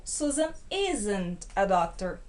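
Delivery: slow, careful English with "isn't" said like "iz-ent"; teacher slowly modelling "isn't" as "iz-ent"; pronunciation demo. Shown as a demonstration. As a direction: model "Susan isn't a doctor" slowly for students. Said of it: "pronúncia".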